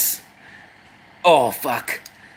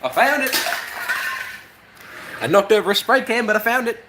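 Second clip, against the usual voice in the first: squeaky excited; 8 semitones higher, faster than usual. Acted. Squeaky found-it bit.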